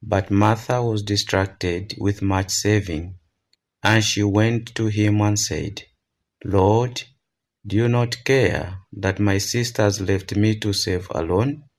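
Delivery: usual speaking voice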